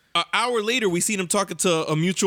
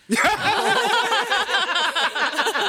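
high burst of laughter; big laugh; 13 semitones higher than usual. Real, not acted. Host laughing hard at the story.

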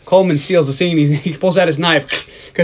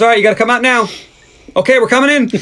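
higher mock police announcement; imitated bullhorn call; 7 semitones higher, 3 dB louder, faster than usual. Sergeant ordering Mickey to come out, mock bullhorn call. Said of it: "Gets his bullhorn out".

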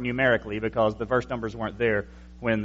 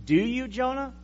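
higher, doubting challenge; incredulous question; 9 semitones higher, 3 dB quieter than usual. Acted. Preacher challenges Jonah's claim to fear God, higher and incredulous. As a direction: challenge Jonah, pitch up in disbelief.

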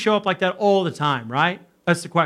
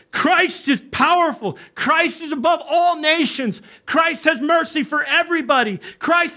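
high, exalted proclamation; proclaiming; 9 semitones higher than usual. Retold or imitated. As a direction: declare each line high, like a translator in awe. Amir translating the tongues to the room.

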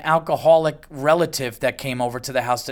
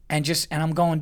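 usual speaking voice

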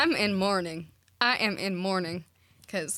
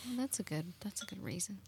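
whispering; 12 dB quieter, faster than usual. Acted. Whispered aside in scene.

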